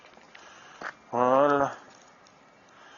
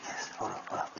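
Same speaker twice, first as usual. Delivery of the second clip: whispered urging to the dog; whispering; 5 semitones lower, 12 dB quieter, much faster than usual. Real, not acted. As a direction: breathe it to the dog.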